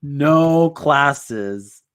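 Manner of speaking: ordinary talk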